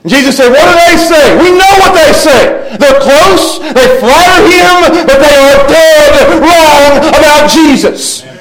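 high, intense pulpit delivery; full-force preaching. Real, not acted. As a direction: preach it hard, stretching key words high.

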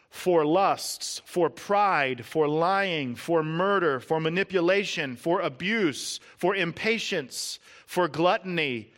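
loud, slow, hammering list; forceful preaching. Acted. Pastor driving home the cost of sin to congregation, forceful preaching. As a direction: pound out each sin like a drumbeat.

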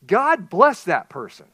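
loud, slow heartfelt blessing; sincere blessing. Acted. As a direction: bless them with all your heart. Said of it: "a true, a sincere bless your heart".

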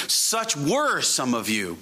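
"were" drawn out and leaned on; stress on "were". Shown as a demonstration. Preacher quotes Paul to his congregation, stretching "were". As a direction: dwell slowly on the word "were". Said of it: "the thing to emphasize here".

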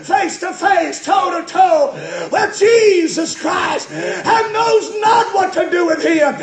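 building to high, loud, driving sermon shout; full-force preaching. Real, not acted. Preacher pressing the congregation about facing Jesus, full-force preaching. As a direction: preach it harder with each phrase.

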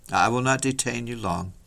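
usual speaking voice

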